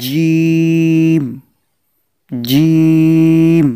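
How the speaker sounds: "জিম" with a hard, firm j, very drawn out; শক্ত জিম. Shown as a demonstration. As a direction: sound "জিম" strong and hold it long. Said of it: "শক্ত এবং মজবুত আওয়াজে". ("জিম" শক্ত ও মজবুত আওয়াজে টেনে)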